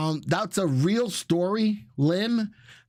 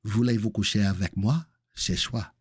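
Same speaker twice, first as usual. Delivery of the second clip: lower-pitched amateur French accent; showing off French; 5 semitones lower than usual. Shown as a demonstration. Host showing his French again, put-on French accent. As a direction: demonstrate your French accent proudly, pitched down. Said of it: "you wanna hear my French again?".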